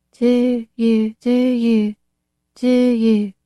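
put-on high, thick, weak girl's voice; a thick, feeble echo. A narrator's reading. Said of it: "a thick, weak voice".

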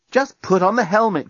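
high, insistent order; impatient cry. A narrator's reading. Lucy urging Dan into the helmet, impatient cry. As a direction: cry it out, pitched well up. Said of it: "cried Lucy".

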